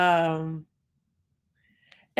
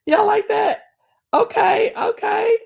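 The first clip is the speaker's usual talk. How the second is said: much higher, loud squealing okays; excited cheering; 7 dB louder than usual. Real, not acted. Thanking viewers for a gift, squealing with excitement. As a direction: hype up the chat. Thrilled reaction after a gift.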